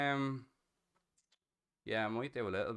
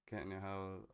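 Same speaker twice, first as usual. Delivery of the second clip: ordinary delivery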